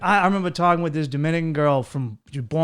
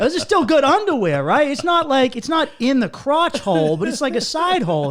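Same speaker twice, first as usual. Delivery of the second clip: excited pleading; 10 semitones higher, 5 dB louder than usual. Acted. Pleading his underwear case.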